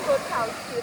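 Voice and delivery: ordinary delivery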